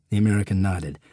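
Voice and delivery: plain reading voice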